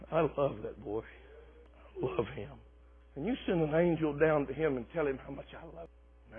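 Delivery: God speaking tenderly about Daniel to an angel. Acted. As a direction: say it softly as a loving father.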